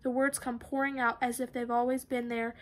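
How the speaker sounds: ordinary delivery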